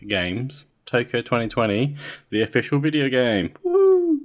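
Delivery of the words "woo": high loud cheering; excited whoop. Real, not acted. Cheering the game's reveal.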